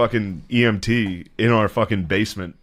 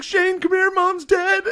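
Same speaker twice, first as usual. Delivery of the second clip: much higher, frantic mock panic; panicked sister; slower than usual. Sister yelling for mom at the bottom of the stairs, high mock panic. Sister calls brother over.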